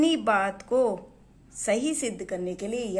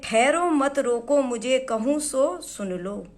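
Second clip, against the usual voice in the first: poem recitation; 4 semitones higher, 6 dB louder than usual. Acted. Recites Kaikeyi's plea.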